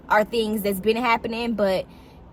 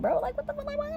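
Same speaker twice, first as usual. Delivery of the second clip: high disbelieving squeal; 7 dB quieter, faster than usual. Retold or imitated. Telling mom about the weird car noise, in high disbelief. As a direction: pitch shoots way up in mock shock. Retelling what she said to mom.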